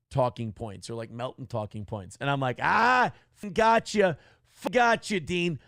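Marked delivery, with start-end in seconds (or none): 2.6-5.6 loud, high taunting gotcha; gloating taunt at the caller; mocking gotcha. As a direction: rub it in his face. Mocking the caught opponent.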